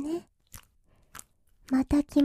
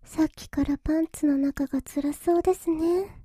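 ordinary delivery

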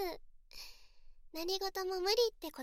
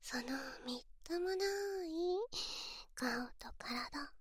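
mocking whisper; 3 dB quieter than usual. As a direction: sneer it under your breath. Taunting aside in a hushed voice.

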